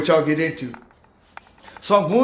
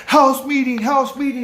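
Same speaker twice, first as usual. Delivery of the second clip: raised, higher announcing call; 7 semitones higher, 4 dB louder than usual. Retold or imitated. Staff call residents at bedtime, calling out loudly. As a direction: summon the whole house.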